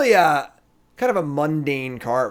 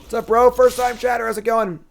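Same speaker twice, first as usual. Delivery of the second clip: excited greeting; 9 semitones higher, 5 dB louder than usual. Real, not acted. Greeting a first-time chatter.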